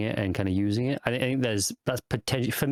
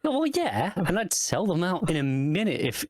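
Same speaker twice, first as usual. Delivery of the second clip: much higher, cheerful Ferengi voice; a gleeful Ferengi admitting he'd sell friends out; Quark impression; 8 semitones higher than usual. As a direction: pitch way up and shrug it off as Quark. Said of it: "And then Quark's like".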